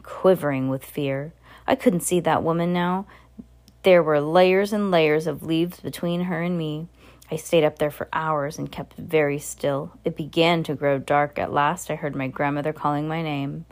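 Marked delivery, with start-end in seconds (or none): none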